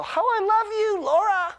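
high, loud singsong to a little daughter; doting father. Retold or imitated. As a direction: gush adoringly way up in pitch. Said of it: "I would pick up my daughter and I would go".

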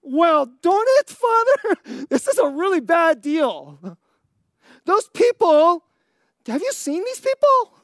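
high, loud whiny grumbling at the Father; hammed-up indignant whine; mock exasperated complaint. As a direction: ham up a fed-up complainer. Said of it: "He could have been like".

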